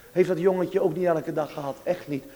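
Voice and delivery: usual speaking voice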